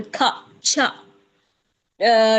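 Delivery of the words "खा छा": "खा", "छा" each said alone as a separate syllable; isolated syllables. Shown as a demonstration. Teacher modelling correct syllables, "खा" and "छा" said separately. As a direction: sound out "खा" and "छा" one by one with a gap between. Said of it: "syllable सही तरीके से बोल".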